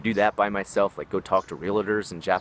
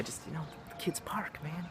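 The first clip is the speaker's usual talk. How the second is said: breathless whispered aside; hushed disbelief under his breath; 7 semitones higher, 14 dB quieter than usual. Real, not acted.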